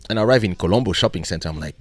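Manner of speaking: ordinary talk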